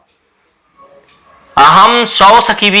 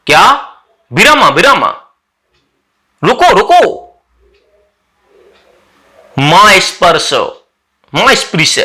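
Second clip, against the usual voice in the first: angry. Acted. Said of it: "सक्रोधम, गुस्से में".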